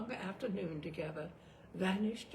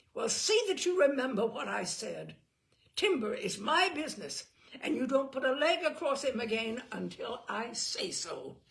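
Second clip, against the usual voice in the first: angry; 6 semitones higher, 8 dB louder than usual. A narrator's reading. Simon still furious.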